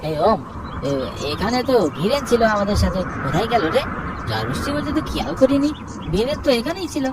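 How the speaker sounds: put-on anxious young Angshu asking after missing friend; worried boy. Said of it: "Anshu বলে".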